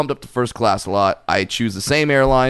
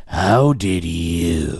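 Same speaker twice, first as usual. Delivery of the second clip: snide question; 5 semitones lower, much slower than usual. Retold or imitated. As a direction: lower and very slow, accusing. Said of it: "I took it as".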